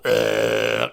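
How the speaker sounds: tuneless droning "uhhh"; god-awful singing. Retold or imitated. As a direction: imitate your own awful note in the choir. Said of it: "you do hear this god-awful".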